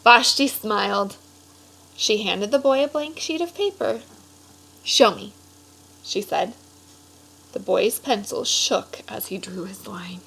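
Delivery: lively storybook read-aloud voice; animated picture-book narration; reading to children. A narrator's reading. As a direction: read it like story time for a class.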